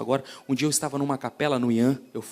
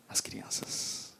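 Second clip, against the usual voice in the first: whisper; 14 dB quieter, much slower than usual. Acted. Sermon point whispered.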